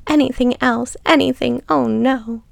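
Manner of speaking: acted crying, voice lifted in a plea. A narrator's reading. Captive pleads with her captor, acted crying plea. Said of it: "She cried uncontrollably".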